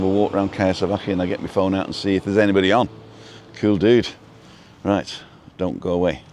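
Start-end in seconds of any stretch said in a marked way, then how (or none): none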